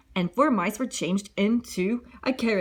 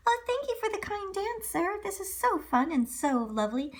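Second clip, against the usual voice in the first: put-on shy girl; 10 semitones higher, 4 dB quieter than usual. Cinderella to prince.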